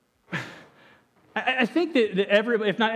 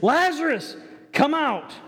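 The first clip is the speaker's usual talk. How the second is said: higher, raised call to the tomb; 7 semitones higher than usual. Acted. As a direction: call out to Lazarus. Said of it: "And here it says".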